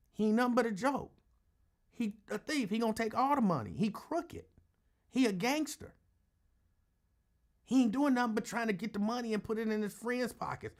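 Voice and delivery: high, animated mock griping; high-voiced imitation of a complainer; mimicked supporter complaints. Recites supporter's complaints about his guy.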